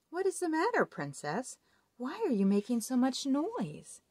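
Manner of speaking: a high, curious frog voice; lightly voiced frog. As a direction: ask like a nosy little frog. Frog voice in story.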